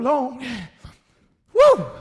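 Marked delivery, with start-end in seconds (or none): 1.5-1.9 high, loud, swooping "woo!"; loud whoop. Pretend. Preacher reacting to a bad smell, loud high whoop. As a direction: whoop it out at the stink. Exclaims at the stink.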